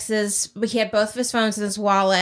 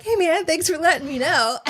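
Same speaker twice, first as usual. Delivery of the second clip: higher, breezy bro voice; casual passerby; 8 semitones higher than usual. Retold or imitated. Ex warns passerby about gunmen, breezy high thanks. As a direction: toss off a careless thank-you, pitched up.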